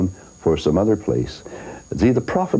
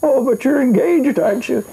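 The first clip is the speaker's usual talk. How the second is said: higher, insistent mock old man; the husband not giving up, higher; old man insisting; 4 dB louder than usual. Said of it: "He said".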